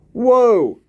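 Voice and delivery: sudden high, loud cry of shock; jolted by the number; startled whoa. Real, not acted. Shocked at the shooting count.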